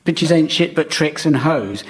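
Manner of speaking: ordinary talk, nothing marked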